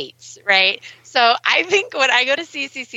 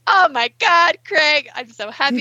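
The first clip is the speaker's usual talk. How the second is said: gushing excitedly; 4 semitones higher than usual. Retold or imitated. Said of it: "And I'm all emotional".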